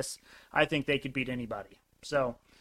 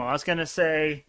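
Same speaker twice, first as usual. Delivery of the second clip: ordinary talk, nothing marked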